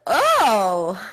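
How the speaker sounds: startled oh, loud and sudden; startled gasp. Retold or imitated. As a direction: jump in fright. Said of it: "And I was like".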